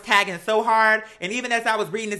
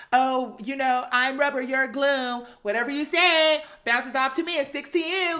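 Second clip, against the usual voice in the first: put-on higher, childish taunting voice; mocking one-liners; 5 semitones higher than usual. Mocking Kim's comeback for viewers, childish taunt. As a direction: sing the rubber-and-glue taunt like a kid. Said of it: "hitting you with one-liners".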